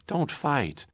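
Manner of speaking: put-on a child begging his father. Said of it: "he begged".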